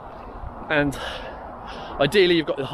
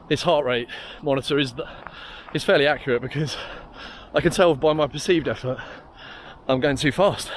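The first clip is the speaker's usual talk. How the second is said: normal talking voice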